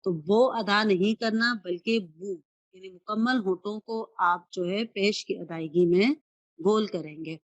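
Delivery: ordinary talk, nothing marked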